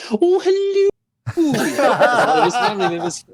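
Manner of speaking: very high, loud, exaggerated Scottish greeting; Scottish family accent. Imitating him answering a relative's phone call, in a broad Scottish accent. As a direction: squeal it in a comic mock Scottish accent.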